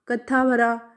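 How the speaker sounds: usual speaking voice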